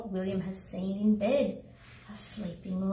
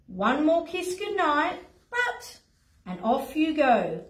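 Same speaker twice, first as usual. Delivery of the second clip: usual speaking voice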